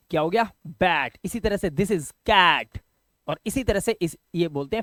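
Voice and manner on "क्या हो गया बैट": usual speaking voice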